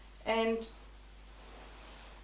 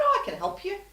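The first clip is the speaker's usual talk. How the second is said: chirpy helper voice; 12 semitones higher, 5 dB louder than usual. Retold or imitated. Girl's chirpy offer voiced.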